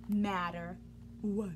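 ordinary delivery, nothing marked

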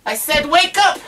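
put-on playful parent shouting the baby awake; mock stern wake-up. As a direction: pretend to be strict, playfully. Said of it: "No laughing".

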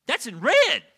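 loud, high exclamation; emphatic amazement. Retold or imitated. New believer pointing at Jesus's words, emphatic amazement.

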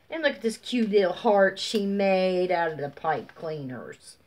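normal talking voice

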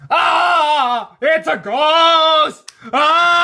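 high, loud panicked shrieks; loud high frightened cry; terrified screaming. Acted. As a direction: scream like you've seen a ghost. Duck frightened by Diesel's prank.

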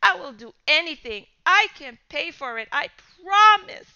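rising to a high pleading cry; desperate begging. Acted. Boy begging the father for mercy, desperate. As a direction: beg for mercy.

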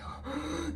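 sharp high mock gasp; relieved gasp. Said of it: "I'm like".

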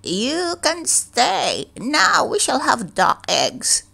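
put-on high, slow old woman's voice; a pleased old woman planning eggs. A narrator's reading. Old woman taking in the duckling, high and creaky. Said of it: "The old woman said".

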